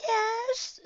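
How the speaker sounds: high, tearful little voice; sobbing. A narrator's reading. Shy pony hit by snow, sobbing. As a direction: whimper it through acted tears. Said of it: "she sobs".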